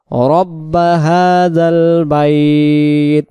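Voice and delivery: slow drawn-out tajweed recitation; measured Quranic chant. Shown as a demonstration. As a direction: recite each word slowly and carefully. Recitation of the verse.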